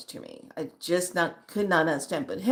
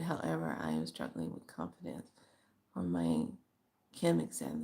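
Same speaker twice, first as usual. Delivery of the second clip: much lower, softer, understated reading voice; reading a comment; 14 semitones lower, 12 dB quieter than usual. Retold or imitated. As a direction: murmur the follower's words low and quiet. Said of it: "reads follower's comment in low voice".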